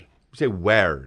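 slow "weird" turned into "wared"; mimicked mispronunciation. Correcting the other host's "weird", mimicked mispronunciation. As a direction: copy his "weird" with an open air vowel, drawn out. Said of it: "Why do you say weird?".

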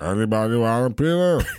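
low, slurred giant rumble; slurred giant line; Andre the Giant voice. Retold or imitated. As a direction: mumble it like a huge gentle giant. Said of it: "what they called his shits".

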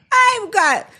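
high, pleading mock mother; pleading drunk mother. Drunk woman pleading with police about her kids, high. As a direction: whine it like a drunk begging to go home. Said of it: "these women that are like".

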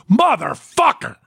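sudden high yelled curse; onstage curse. Retold or imitated. Juggler fumbling the last bottle, yelling a high curse. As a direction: blurt it out in a high yell. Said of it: "And I went".

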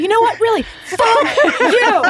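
high, loud retold yell at her husband; retold shout. Wife retelling how she yelled at her husband through the back door, loud shout. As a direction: belt it out like slamming the door on him. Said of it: "and I go".

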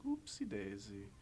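a dazed, feeble apology; feeble oopsie. Retold or imitated. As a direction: mutter it feebly like a stoned man. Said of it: "you just feebly saying".

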